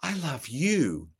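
softened, slow delivery; God's gentle whisper. Acted. Preacher asks if listeners heard God say it, softly. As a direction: say it softly, drawing out the words. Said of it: "He's gonna whisper".

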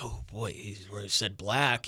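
slow, low, soft mock alarm; imitated hushed offense. Guilty onlookers reacting to a joke at a show, imitated hushed offense. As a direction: murmur it slowly, imitating a scandalized onlooker.

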